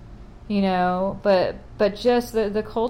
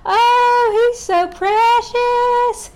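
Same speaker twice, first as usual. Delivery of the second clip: much higher, louder, slow mock cooing aunt; gushing aunts; 8 dB louder, much slower than usual. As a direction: coo it like a doting aunt hamming it up. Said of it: "they're like".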